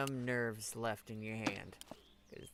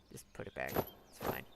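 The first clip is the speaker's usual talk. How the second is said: whispering; 13 semitones lower, 6 dB quieter than usual. Acted. Quiet aside after a miss.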